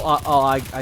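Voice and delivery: ordinary talk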